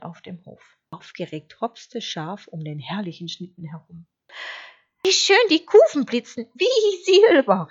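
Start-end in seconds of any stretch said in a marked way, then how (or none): 5.0-7.7 delighted cry. A narrator's reading. Excited sheep admiring the sleigh's runners, delighted cry. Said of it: "rief es".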